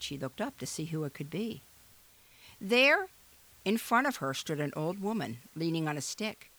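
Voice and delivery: ordinary delivery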